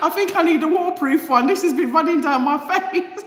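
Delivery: high, loud giggling through the words; real delighted laughter; laughing. Real, not acted. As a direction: laugh it out about running mascara. Laughing about mascara running.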